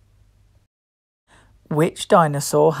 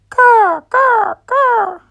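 much higher crying caw calls; pterodactyl caw; 4 dB louder, much slower than usual. Acted. Quizzing children, high cawing pterodactyl call. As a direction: call out like a flying reptile.

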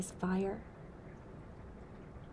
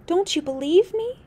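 higher, louder plea; pleading; 11 semitones higher, 8 dB louder than usual. Acted. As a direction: ask with hurt urgency. Listener pleads with Otter Woman.

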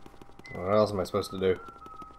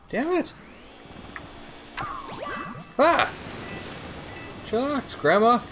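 frustrated high yell; 14 semitones higher than usual. Real, not acted.

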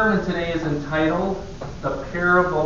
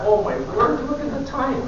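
mock alarm; 6 semitones higher than usual. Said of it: "and I thought".

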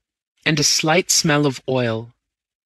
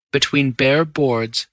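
ordinary delivery

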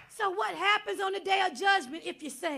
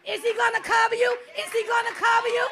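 normal talking voice

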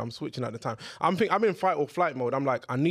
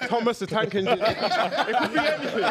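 laughing; 5 semitones higher than usual. Real, not acted. Laughing at own joke.

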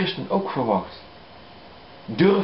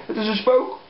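startled cry; 9 semitones higher than usual. Acted. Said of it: "werden zij verbijsterd en zeiden".

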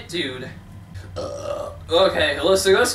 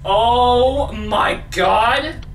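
very slow, stretched-out exclamation; shocked; much slower than usual. Real, not acted. Player stunned by the new level, drawn-out shock. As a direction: draw each word out in shock.

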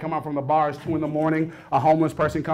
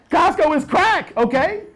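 excited; 10 semitones higher, slower than usual. Acted. Comic emphasis on Costco.

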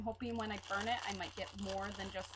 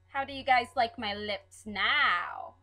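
playful showing off; 10 dB louder than usual. Pretend. Showing off wax lips to viewers.

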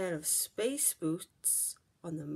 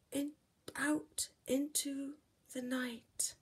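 whisper; 5 semitones higher than usual. A narrator's reading. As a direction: let the night hush it. Flying out into night.

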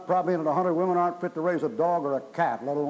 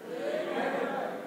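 breathy chuckle; 7 dB quieter than usual. Real, not acted. Chuckles at his own point.

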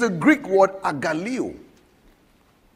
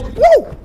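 high, loud shout of "Woo!"; wild joyful whoop; 8 dB louder than usual. Retold or imitated. Jesus rejoicing while they watch, high loud whoop. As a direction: scream it high with wild joy. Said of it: "And he goes".

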